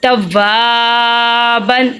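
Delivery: توابن with a very long "waa", short "ban"; lengthened middle vowel. Shown as a demonstration. Teacher showing where to lengthen, drawn-out middle vowel. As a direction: hold the middle of توابن, then close briefly on "ban". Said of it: "اس کو ہم لمبا کرتے ہیں".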